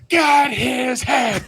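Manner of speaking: high, very slow yelled chant; drawn-out high crowd yell; crowd shout. Pretend. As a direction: roar it like a mob in the bleachers.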